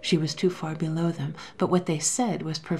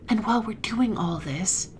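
excited whisper; 5 semitones higher than usual. A narrator's reading. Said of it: "whispered the other excitedly".

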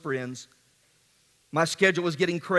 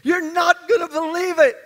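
higher, louder excited news; excited friend; 10 semitones higher, 5 dB louder than usual. Retold or imitated. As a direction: burst in with unbelievable news. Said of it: "He said".

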